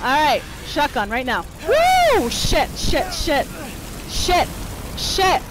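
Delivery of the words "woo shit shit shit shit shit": high yelp, then loud short curses; panicked swearing. Real, not acted. Player under attack, panicked swearing. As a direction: yelp and spit repeated curses in alarm.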